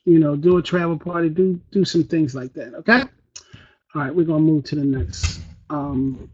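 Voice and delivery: low, soft, lifeless presenter; put-on boring delivery, fading away; unexcited. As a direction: plod through it with no energy. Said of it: "You're not excited about your business".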